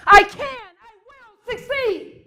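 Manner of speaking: high, slow, full-out shout; shouted with conviction. Shown as a demonstration. Speaker shows the audience how to mean it, huge shout. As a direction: yell it like you truly mean it, each word stretched. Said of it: "then I'm gonna say it like this".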